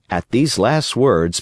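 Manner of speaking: ordinary delivery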